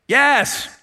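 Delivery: a hammed-up indignant retort. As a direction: snap back like a sulking prophet. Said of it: "Jonah retorted".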